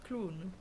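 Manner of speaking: "Clown" said the French way, as "kloon"; French pronunciation. Shown as a demonstration. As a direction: repeat "Clown" with a long oo. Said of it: "We pronounce it clown".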